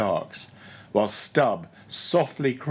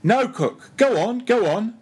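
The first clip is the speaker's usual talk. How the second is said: eager urging; 10 semitones higher, 5 dB louder than usual. A narrator's reading. Stubb urging him on.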